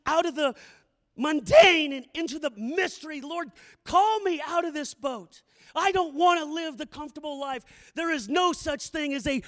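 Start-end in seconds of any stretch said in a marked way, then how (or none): none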